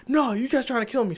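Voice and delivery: high-pitched retold protest; mock frantic complaint; imitated freak-out. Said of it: "she starts freaking out".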